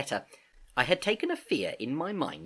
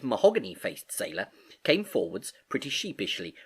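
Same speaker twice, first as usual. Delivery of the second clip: ordinary delivery, nothing marked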